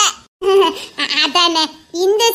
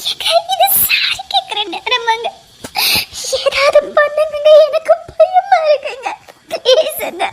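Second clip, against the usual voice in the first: crying plea; 4 semitones higher than usual. Acted. Wife crying and pleading.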